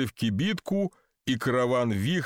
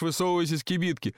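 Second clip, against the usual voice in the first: usual speaking voice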